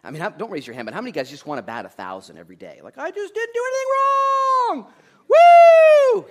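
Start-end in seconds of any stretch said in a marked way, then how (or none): 3.0-6.2 high, loud, drawn-out celebration; mock triumphant whoop. Man boasting of batting a thousand, mock triumphant shout. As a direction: crow it like a perfect scorer. Acts out wishing for perfect day.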